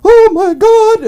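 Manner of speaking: very high, loud, drawn-out shriek; hammed-up old lady's startled wail; startled cry. Elderly lady lifted up by worker standing, startled.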